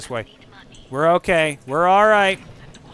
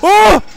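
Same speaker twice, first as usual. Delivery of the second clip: very high, loud yelp; sudden shriek of surprise; startled yelp; 15 dB louder than usual. Real, not acted. Streamer caught off guard in game, startled yelp.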